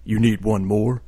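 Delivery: slow, put-on deep voice. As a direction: drop your voice as low as it goes. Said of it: "in my deepest voice".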